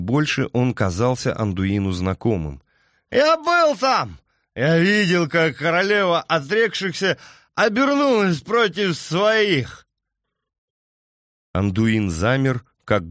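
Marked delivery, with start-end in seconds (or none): 3.1-9.9 put-on drunken outcry. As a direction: cry it out, drunk and haunted. Drunk man cries out.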